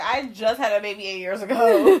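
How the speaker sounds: loud, playful mock protest; loudly indignant in jest; mock defensive. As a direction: protest it loudly, as if it excuses everything. Playful defensive excuse to critics.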